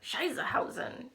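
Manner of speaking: wife mimicking her confused husband's made-up German word; imitating Benny. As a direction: toss off his silly word like he did. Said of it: "And Benny says, like".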